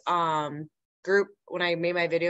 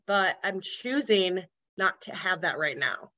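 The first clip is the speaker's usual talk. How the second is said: ordinary talk, nothing marked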